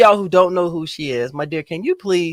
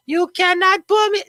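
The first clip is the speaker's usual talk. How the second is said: high, put-on island accent, bashful denial; a hint of a Caribbean island accent, much higher; mock island accent; 11 semitones higher, slower than usual. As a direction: imitate a shy island accent, badly. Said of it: "That's not her accent".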